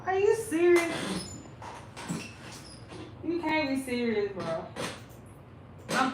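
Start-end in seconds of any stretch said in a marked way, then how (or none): none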